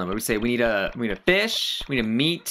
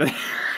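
quick breathy laugh; amused laugh; 4 dB quieter than usual. Real, not acted. Laughing at her own date story, amused. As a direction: break into a real laugh mid-story.

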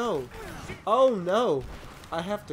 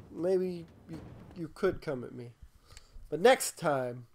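usual speaking voice

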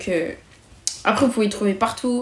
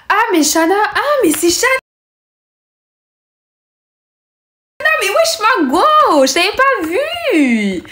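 put-on high squealed girlish hello, much higher; mock excited greeting; 11 semitones higher, 5 dB louder, slower than usual. Friend spotting Shana in the corridor, squealing high greeting. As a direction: squeal it like spotting your bestie.

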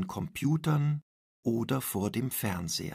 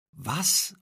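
a sharp, higher Was; surprised cry; 5 semitones higher than usual. A narrator's reading. Paula hears about Anton's photos, surprised outcry. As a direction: blurt it out, caught off guard. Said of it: "ruft Paula".

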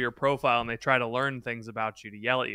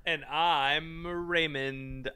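slow, softer put-on voice; mock Raymond introduction; 2 semitones higher, 5 dB quieter, much slower than usual. Cohost pretending to be Raymond in the intro, slow and soft. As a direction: drag it out like a fake star. Joke intro as sitcom star.

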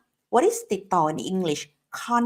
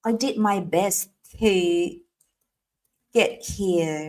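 slow drill; much slower than usual. Shown as a demonstration. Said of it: "มาเป็นประโยคขนาดนี้เลยนะคะ".

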